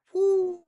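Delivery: loud, high drawn-out "whoo"; excited whoo. Real, not acted. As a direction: let out a high, ringing whoo. Excited reveal of best move.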